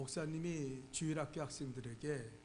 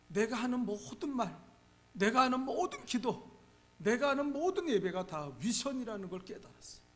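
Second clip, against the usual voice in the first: higher and louder, building confession; impassioned preaching; 5 semitones higher, 8 dB louder than usual. Real, not acted. Preacher confessing his hypocrisy to the congregation, impassioned. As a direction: cry it out from the pulpit.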